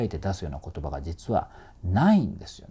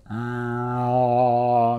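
long "aah" swelling louder into a big hollow space; wide English-style resonance; 5 dB louder than usual. Shown as a demonstration. Coach demonstrates the wider English space, loud resonant "aah". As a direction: open up inside and let "aah" boom. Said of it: "空間が広くなって".

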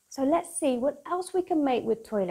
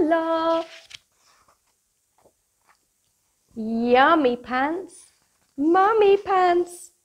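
bright, bouncy storybook read-aloud; lively picture book voice for kids; reading to children; 5 semitones higher, 8 dB louder, slower than usual. Acted. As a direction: read it like bedtime story time for little ones.

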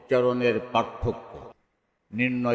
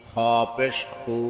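final "খু" cut short; quick short vowel. Shown as a demonstration. Teacher demonstrating normal pesh, short "খু". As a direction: keep the last "খু" brief, not held. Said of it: "তাড়াতাড়ি করতে হয়".

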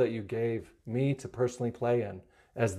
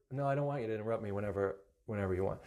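quick correction; 4 semitones lower, 9 dB quieter, much faster than usual. Real, not acted. Correcting himself quickly, softer.

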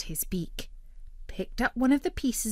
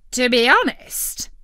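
loud, higher greedy bird; brash turkey voice; 5 semitones higher, 9 dB louder than usual. A narrator's reading. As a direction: argue like a greedy cartoon bird.

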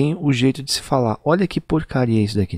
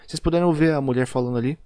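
normal talking voice

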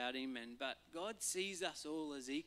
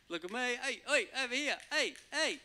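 higher, louder calls hey hey; calling out; 9 semitones higher, 5 dB louder than usual. Retold or imitated. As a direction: wave someone down across a field. God calling for attention.

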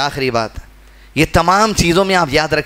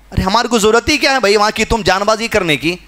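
ordinary delivery

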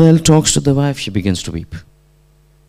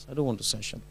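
retold weak refusal; 17 dB quieter, much faster than usual. Dying student refusing his teacher's prompt, quiet refusal.

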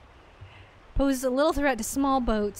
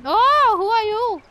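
1 startled; 12 semitones higher, 12 dB louder than usual. Real, not acted. Startled by a new character.

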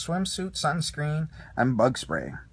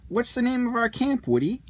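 Slinky Dog asking; 9 semitones higher than usual. A narrator's reading. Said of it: "Slinky Dog asked".

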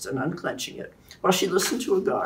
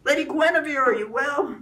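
much higher call across the hall; 10 semitones higher, 4 dB louder than usual. A narrator's reading. Kay rushing up to Guinevere, calling out higher. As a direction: call to her from afar. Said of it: "calling out".

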